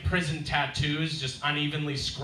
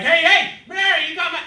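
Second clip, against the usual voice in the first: imitated yelling; 13 semitones higher, 9 dB louder than usual. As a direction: mimic someone yelling from outside. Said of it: "So it's like someone's yelling".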